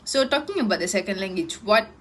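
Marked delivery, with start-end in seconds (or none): none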